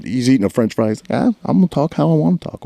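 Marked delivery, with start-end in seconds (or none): 1.2-2.6 higher-pitched mock brush-off; imitated defiance. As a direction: imitate a mouthy kid shrugging you off. Retells the mouthy kid's defiant reply.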